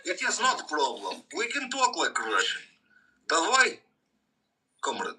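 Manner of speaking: high-pitched, hammed-up Russian accent. Offering the friend to talk like Russian, playful high Russian accent. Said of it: "I can go Russian".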